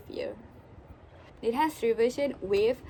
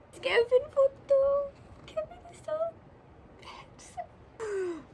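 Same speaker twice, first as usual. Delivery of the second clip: swooning; 13 semitones higher than usual. Real, not acted. Fan looking at Kevin's photo, high swooning whine. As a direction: gush in a small high voice.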